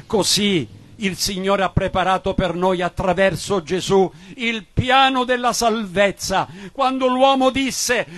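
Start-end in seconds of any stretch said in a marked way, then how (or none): none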